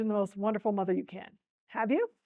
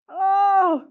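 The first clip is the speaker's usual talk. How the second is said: high, loud "oh" of shock; loud shocked cry; 9 semitones higher, 11 dB louder than usual. A narrator's reading. Beggar drenched by a tub of ice water, loud cry. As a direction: yelp as if doused with icy water. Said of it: "cried the beggar".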